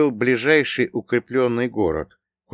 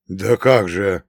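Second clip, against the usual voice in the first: slightly louder, half-hearted protest; mild indignation; 6 dB louder, slower than usual. A narrator's reading. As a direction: try a brief protest. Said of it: "попытался возмутиться Прокоп".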